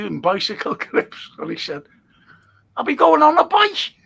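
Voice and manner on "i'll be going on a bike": high, loud, incredulous query; retold baffled question. Lad questioning farmer about bicycle clips, loud mock bafflement.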